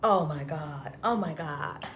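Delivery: usual speaking voice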